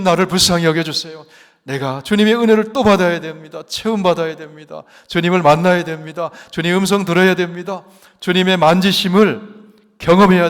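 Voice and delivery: loud, fervent pulpit preaching; preaching at full force. Acted. Pregação em voz alta.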